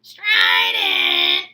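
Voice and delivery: a harsh, strained shout of Strident. Shown as a demonstration. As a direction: belt out "Strident!" with a harsh cry. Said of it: "Remember when I told you about the word strident?".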